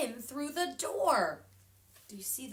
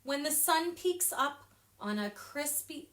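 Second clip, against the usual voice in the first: ordinary delivery, nothing marked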